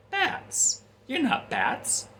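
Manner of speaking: high, mocking villain squeak; Joker voice. Joker taunting Robin in a Batman suit, high mocking squeal. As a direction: tease him like a giddy madman.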